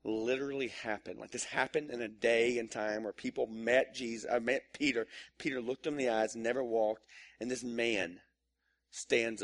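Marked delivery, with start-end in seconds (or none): none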